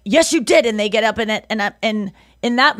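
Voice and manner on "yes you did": insisting quote. Retold or imitated. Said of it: "and they'd be like".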